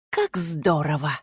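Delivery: soft, slow and low, sweetly; sweetly gentle. A narrator's reading. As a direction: coo it with false tenderness. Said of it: "голос Грон вновь стал ласковым".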